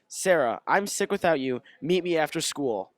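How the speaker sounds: normal talking voice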